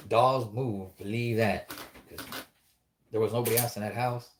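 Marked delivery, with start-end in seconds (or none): none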